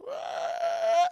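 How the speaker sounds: very high, loud ghostly screech; jump-scare ghost shriek. Retold or imitated.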